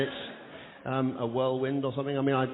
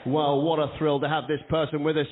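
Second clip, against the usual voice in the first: ordinary delivery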